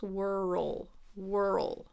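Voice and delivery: "Whirl" drawn out as wer-ul; two syllables. Shown as a demonstration. Teacher explains the IPA, "Whirl" in two syllables. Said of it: "almost more like two syllables".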